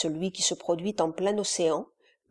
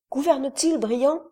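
put-on a boy's anxious call over the storm, raised; young sailor checks the helm, a bit higher and louder; boy asking; 6 semitones higher, 4 dB louder than usual. A narrator's reading. Boy shouting to Briand over the storm, anxious. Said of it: "demanda l'un d'eux".

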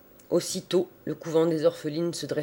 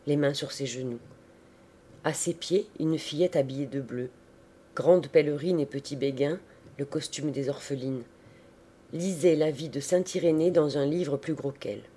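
ordinary delivery

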